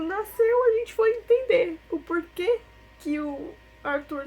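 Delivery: voz chorosa, aguda e embargada; choro real. Real, not acted. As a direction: tentar continuar falando em meio ao choro. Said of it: "é impossível falar e não chorar". (falando entre lágrimas, voz embargada)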